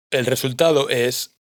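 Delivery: ordinary delivery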